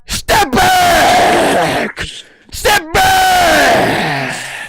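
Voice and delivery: high, loud barked order; hammed-up falsetto yell; mock command shout. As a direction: shout them back like a guard. Ordering someone away.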